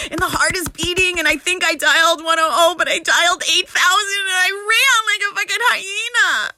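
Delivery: high, agitated, breakneck storytelling; retold panic. Telling how they dialed police and ran, panicked. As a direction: build to a high pitch of alarm.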